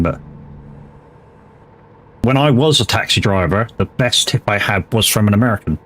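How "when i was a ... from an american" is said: ordinary delivery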